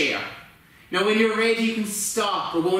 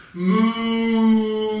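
playful mock cow moo for the kids; 3 dB louder than usual. Yoga teacher to children in cow pose, playful mooing. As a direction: moo like a cow, a little louder. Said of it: "What does a cow say?".

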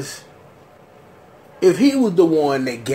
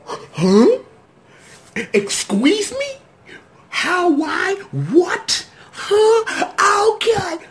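high, squeaky questions of shock; stunned disbelief; 13 semitones higher, much slower than usual. Real, not acted. Viewer baffled by the plot twist, high stunned questions. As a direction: sound totally thrown.

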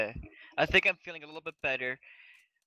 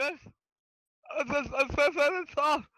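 high stuttering altered voice; stuck repeating, voice shot up high; voice going off; 9 semitones higher than usual. Real, not acted. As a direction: stammer in a pitched-up voice. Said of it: "my voice, it's going off".

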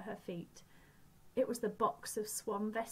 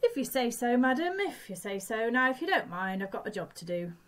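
lightly voiced dismissive guard; a hint of a curt railway guard; guard's voice; 4 semitones higher, 8 dB louder than usual.

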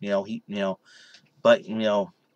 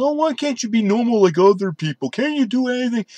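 higher, lightly voiced nagging critic; mocking critics; 9 semitones higher than usual. Mimicking people who tell him to be normal, mocking. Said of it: "people say".

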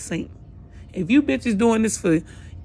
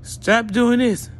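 mocking commenter; 4 semitones higher, slower than usual. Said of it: "talking about some".

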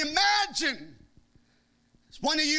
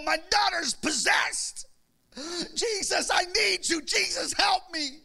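desperate crying out; 7 semitones higher than usual. Retold or imitated. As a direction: beg Jesus for help at full voice. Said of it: "she, she clamored".

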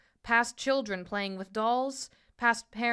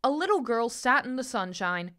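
ordinary delivery

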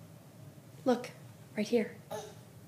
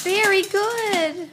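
sing-song praise; 8 semitones higher, 5 dB louder, much slower than usual. Real, not acted. Praising toddler.

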